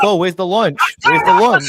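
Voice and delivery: high, loud mock whine for lunch; playful mocking of a pal at the expo; mock hungry friend. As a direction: ham up a friend hunting for lunch. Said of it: "you're gonna be like".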